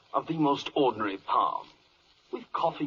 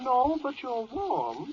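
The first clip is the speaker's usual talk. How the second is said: teasing hint; 12 semitones higher, slower than usual. Acted. Teasing hint in a quiz scene.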